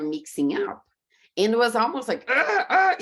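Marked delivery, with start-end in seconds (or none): 2.3-2.9 high yelps of mock pain; high mock wails; mock screams. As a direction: squeal like the words hurt your ears. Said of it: "screaming in my ears".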